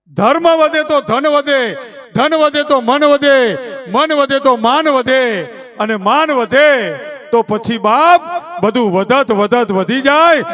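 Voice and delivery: loud, slow, rhythmic verse declaimed line by line; poetic recitation. Shown as a demonstration. Folk poet blessing the host family, loud slow rhythmic recitation. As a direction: chant the couplet slowly like a folk poet. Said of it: "તો અમારો કવિ એમ કહે છે".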